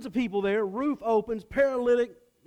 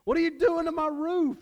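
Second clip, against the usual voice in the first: higher, louder mock outrage; mock angry; 10 semitones higher, 4 dB louder than usual. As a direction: play an irritated homeowner, half-joking. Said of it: "Jesus could have said".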